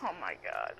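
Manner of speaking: hushed, low whisper; whispered aside. Real, not acted. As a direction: whisper it in disbelief. Aside after the squealed correction.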